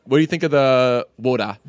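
WATER in a put-on accent; borrowed accent on WATER; imitating Birdie's accent. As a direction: say WATER with her accent, dropping the R. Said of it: "I love her accent so much".

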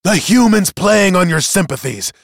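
high, loud, indignant tirade; explosive outburst. Acted. Accuser interrupts the testimony before the tribunal, exploding. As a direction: explode with accusations, piling them up. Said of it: "he exploded".

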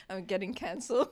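high laugh, talking through giggles. Real, not acted. Laughing at her own language.

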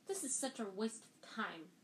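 usual speaking voice

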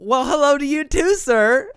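high, loud sarcastic greeting; hammed-up comeback to the boss; mock cheery retort. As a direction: call it back with exaggerated politeness. Acts out reply to scolding.

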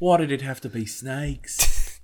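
slow weary movie line; drawn-out world-weary hero line; Harrison Ford quote. Pretend. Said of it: "other Harrison Ford lines".

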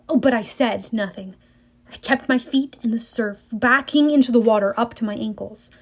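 plain reading voice

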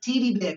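high-pitched "Titty", short I for teddy's E; Kiwi vowel shift. Acted. Imitating Kiwis at camp, high "Titty" for teddy. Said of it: "anything with an E, it was an I".

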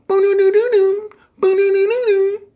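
high sung beeps; phone startup jingle. Retold or imitated. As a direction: sing the phone chime. Imitating phone startup sound.

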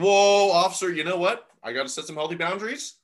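loud, high whoa, then easing off; mock protest. Joking driver to officer at speeding stop, mock protest. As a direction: pretend to halt someone in their tracks. Joke: stopping a traffic officer.

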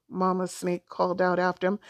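plain reading voice

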